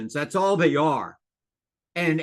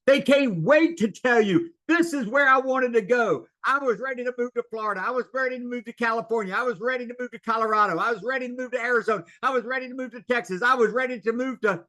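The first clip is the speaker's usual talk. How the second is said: eager seller; 8 semitones higher, faster than usual. Retold or imitated. Mimics eager seller listing destinations.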